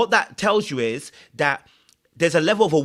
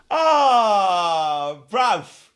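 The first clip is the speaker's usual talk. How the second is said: a louder, crying "Oh, bruv"; mock despairing cry; 2 semitones higher, 3 dB louder than usual. Real, not acted. Comic despairing wail to viewers over his lost English.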